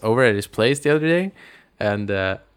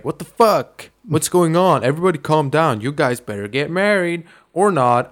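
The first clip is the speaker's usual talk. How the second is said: high, raised-voice mock outrage; dad going ape shit; 5 semitones higher than usual. Dad blowing up at the wedding guests, mock fury. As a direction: imitate an exasperated father blowing up. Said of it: "the dad kinda goes ape shit".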